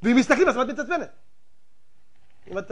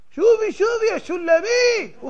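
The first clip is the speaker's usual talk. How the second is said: high, loud mock cheer of the name; mock calling out; 11 semitones higher, 4 dB louder than usual. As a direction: call out to her as if welcoming her back, half-joking. Calling out to Shulamit.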